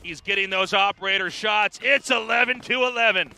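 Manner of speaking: hyped play-by-play call, rising; esports caster. Real, not acted. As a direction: call the comeback with building hype.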